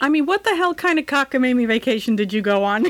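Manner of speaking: normal talking voice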